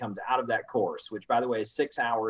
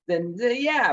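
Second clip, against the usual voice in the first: high, louder acted hesitant yes; wavering yes, pitched well up; tentative agreement; 13 semitones higher, 5 dB louder, slower than usual. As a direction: play a buyer coming round. Acted buyer warming to the idea.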